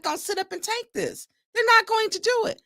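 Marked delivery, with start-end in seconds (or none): 1.5-2.6 higher, fast, emotional push; worked-up outburst. Real, not acted. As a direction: let the frustration spill out fast. Emotional rant about disrespect.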